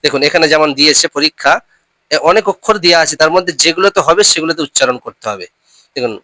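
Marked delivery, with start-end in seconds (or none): none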